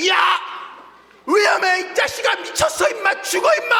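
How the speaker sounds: frantic, very high, loud yell; urgent warning shout. Acted. Preacher as older sibling yelling at a sibling about to fall into hot water, urgent shouting. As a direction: scream a warning to stop someone in danger. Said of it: "이렇게 소리 지르는 것처럼".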